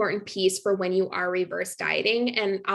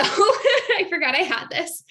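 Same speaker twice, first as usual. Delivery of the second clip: laughs mid-line, higher and louder; amused at finding a forgotten slide; laughing; 8 semitones higher, 5 dB louder than usual. Real, not acted. Presenter laughing as she finds an old slide.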